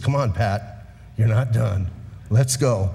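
Jesus encouraging. Retold or imitated. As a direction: lift him up gently and tell him to keep going. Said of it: "Jesus says".

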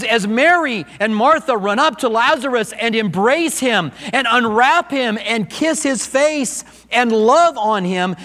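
normal talking voice

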